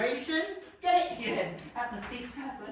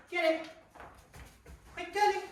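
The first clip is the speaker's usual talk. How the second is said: excited urging; 8 semitones higher than usual. Real, not acted. Urging dog on.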